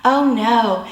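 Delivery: ordinary talk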